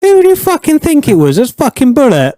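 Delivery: high-pitched indignant answer; comic outrage at the culprit; exasperated. Real, not acted. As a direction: answer as if it's painfully obvious.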